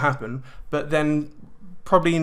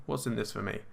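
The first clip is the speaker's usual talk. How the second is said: inner thought, quiet; 4 semitones lower, 11 dB quieter than usual. Retold or imitated. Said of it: "you'll be thinking".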